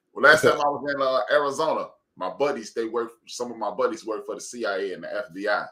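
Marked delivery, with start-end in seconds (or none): none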